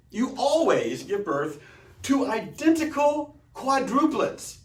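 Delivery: marvelling at the armadillo fact, slow and high; awed emphasis. Real, not acted. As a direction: draw it out in wonder.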